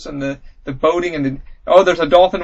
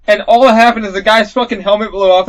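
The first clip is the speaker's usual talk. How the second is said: ordinary delivery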